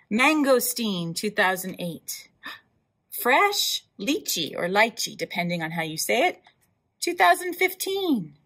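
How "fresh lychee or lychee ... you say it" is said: ordinary delivery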